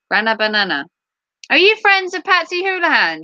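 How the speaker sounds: ordinary talk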